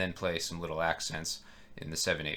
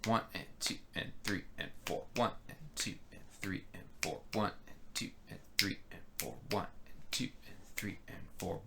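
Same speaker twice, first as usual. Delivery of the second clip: "One and two and three and four" whispered under the breath, softer; whispered count; 9 dB quieter than usual. Shown as a demonstration. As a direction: breathe the count steadily, like keeping time to yourself. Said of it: "a sort of limping feel".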